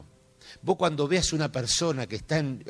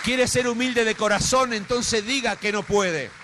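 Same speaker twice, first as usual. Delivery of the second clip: high, loud, pressing sermon call; impassioned preaching; 7 semitones higher, 5 dB louder than usual. Acted. Preacher urging the congregation to humility, loud and high.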